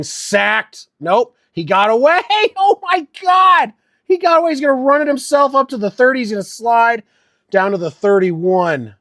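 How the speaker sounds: excited play call. Real, not acted. Fan watching the quarterback escape a sack, thrilled. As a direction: shout the scramble like a thrilled fan.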